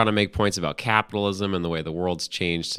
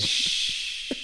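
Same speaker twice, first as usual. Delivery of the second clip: shushing; 6 dB quieter than usual. Real, not acted. Shushing co-host.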